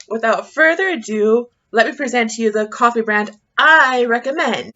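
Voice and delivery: loud, emphatic big reveal; grand presentation. Pretend. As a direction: announce it with mock fanfare. Grand presentation of coffee brand.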